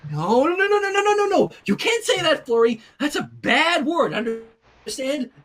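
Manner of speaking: high, loud flustered pleading; panicked correction; frantic no. Acted. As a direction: scramble to undo the damage.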